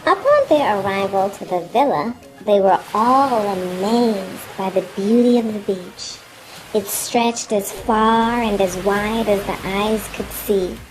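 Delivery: usual speaking voice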